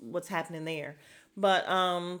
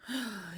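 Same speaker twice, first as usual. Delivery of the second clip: heavy sigh of boredom; weary sigh; 5 dB quieter than usual. Real, not acted. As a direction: sigh before breaking bad news. Weary before recapping a dull date.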